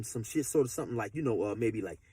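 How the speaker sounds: ordinary delivery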